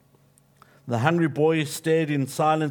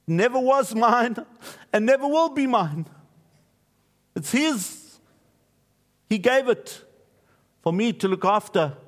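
earnest retold reply; 9 semitones higher than usual. Preacher quoting a giver defending his gift, higher and insistent. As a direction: pitch it higher as the quoted speaker, insisting. Retold dialogue, answering the scold.

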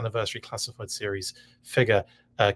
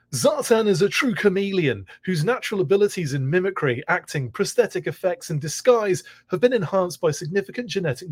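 raised, polished ad announcer delivery; commercial voice; 5 semitones higher than usual. Shown as a demonstration. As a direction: read the copy like a TV ad. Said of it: "That's my, uh, commercial voice".

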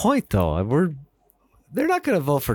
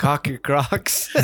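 laughing; much slower than usual. Pretend. Laughing while repeating the joke.

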